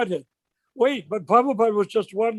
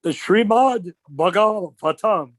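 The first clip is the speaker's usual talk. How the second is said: slow solemn quote; slower than usual. Retold or imitated. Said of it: "That's the way he said it".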